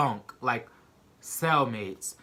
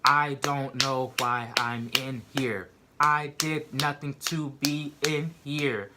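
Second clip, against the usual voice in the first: slow, every word separated; emphatic protest; 3 dB louder, slower than usual. Retold or imitated. Telling cellmates she's innocent, slow and emphatic. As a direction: insist word by word.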